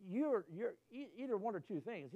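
ordinary delivery

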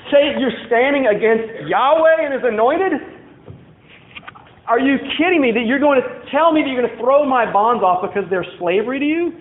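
scornful disbelief. Acted. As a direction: scoff at puny rebels, astonished at their nerve. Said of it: "Laugh like".

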